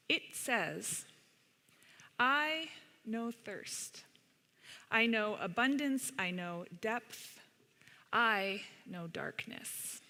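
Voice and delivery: slow, gentle storybook reading with long pauses; unhurried read-aloud storytelling; reading to children. Acted. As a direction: read it like a picture book to a circle of kids. Reads picture book aloud.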